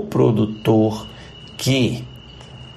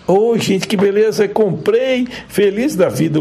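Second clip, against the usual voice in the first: excited; 9 semitones higher than usual. Acted. Delighted about buying strawberries.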